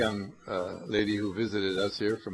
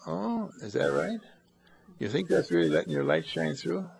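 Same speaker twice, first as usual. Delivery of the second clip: ordinary talk, nothing marked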